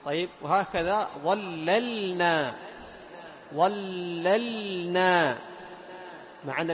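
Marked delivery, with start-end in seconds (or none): 1.2-2.5 slow ظللنا, first l held and second distinct; doubled lam shown. Shown as a demonstration. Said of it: "فتبين توضيح اللام الأولى". 3.5-5.4 very slow chanted ظللنا, both lams held; exaggerated doubled lam. Shown as a demonstration. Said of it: "فتبين توضيح اللام الأولى".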